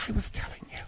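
hushed, pointed whisper. Acted. [name] privately corrects the host, whispering. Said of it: "Oh, you, you're whispering".

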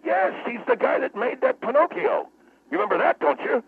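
high, insistent retort; wound up. Acted. Guest insisting Geppetto made Pinocchio, wound up. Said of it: "Will you calm down".

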